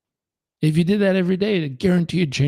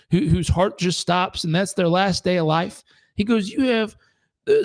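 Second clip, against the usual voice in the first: higher, lightly voiced wise old man; 5 semitones higher than usual. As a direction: preach it like an old drifter.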